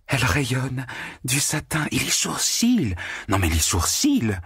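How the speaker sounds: high, breathless admiring exclamations; excited cries. A narrator's reading. Natasha hugging Margarita and crying out in delight. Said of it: "l'embrassant et criant".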